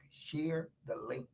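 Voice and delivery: slow, very soft, low coaxing; quiet, drawn-out nudge; hushed urging. Real, not acted. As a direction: draw out "Share the link" almost in a whisper. Urging repeated in slow hush.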